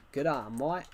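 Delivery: put-on Aussie greeting; Australian accent. Shown as a demonstration. Showing chat he can do accents, Australian accent. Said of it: "I can do Australian accent".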